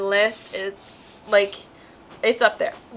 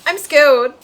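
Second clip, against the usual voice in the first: a louder, hammed-up "I'm scared"; mock scared, louder; 5 semitones higher, 8 dB louder than usual. Reader retelling her reaction to the book, hammed-up fear. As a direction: play up the fake fright, pushing it out. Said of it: "and I was like".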